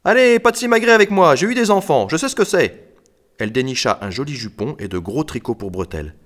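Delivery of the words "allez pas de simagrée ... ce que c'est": loud, high, brisk scolding; crying out. A narrator's reading. Ruby tells shy boys to undress, crying out loud and high. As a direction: brush off their shyness at high volume. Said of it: "Ruby s'écria".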